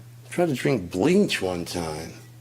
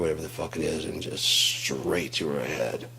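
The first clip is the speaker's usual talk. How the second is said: ordinary talk, nothing marked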